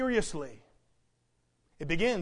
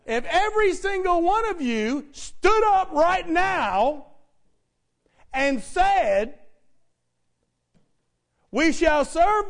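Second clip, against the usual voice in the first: high, loud call to stand; full-force pulpit appeal; insistent preaching; 7 semitones higher, 11 dB louder than usual. Real, not acted. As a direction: rouse the congregation with a ringing vow. Prompts congregation again, full force.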